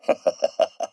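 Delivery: short, breathy chuckle; amused chuckle. Acted. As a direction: chuckle to yourself at the mad idea. Amused by Max's dream.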